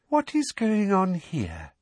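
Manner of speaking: put-on high, slow girlish voice; sugary-sweet and girlish, drawn out; falsely sweet. A narrator's reading. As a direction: ask with syrupy false sweetness, pitched high. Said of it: "said a falsely sweet, girlish voice".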